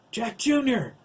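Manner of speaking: high, loud shout of the name; urgent high-pitched call; alarmed exclamation. A narrator's reading. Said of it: "Max exclaimed".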